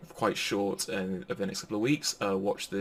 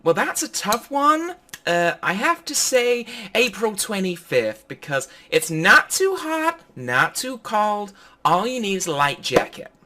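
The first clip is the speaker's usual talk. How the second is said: much higher, louder, slow put-on pageant contestant; Miss Rhode Island impression; 11 semitones higher, 6 dB louder than usual. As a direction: coo it like a sweet, earnest beauty queen. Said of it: "And Miss Rhode Island goes".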